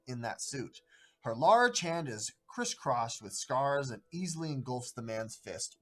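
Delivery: ordinary talk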